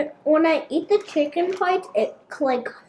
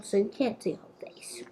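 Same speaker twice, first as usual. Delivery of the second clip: whispering; 6 semitones lower, 9 dB quieter than usual. Real, not acted. Aside about mom behind camera.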